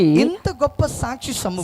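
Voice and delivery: loud, slow, drawn-out scripture call; proclaimed verse. Real, not acted. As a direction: raise the pitch and stretch each word out. Echoes the reader's verse.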